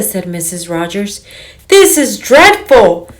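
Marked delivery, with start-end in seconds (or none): 1.7-3.1 dismayed. Acted. Mrs Rogers in sudden alarm.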